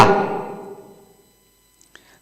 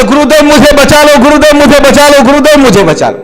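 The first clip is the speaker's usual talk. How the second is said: higher, louder begging for rescue; pleading cry; 5 semitones higher, 3 dB louder than usual. Acted. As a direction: beg the guru desperately to save you. Friend begs the saint. (ऊँची आवाज़ में गिड़गिड़ाना)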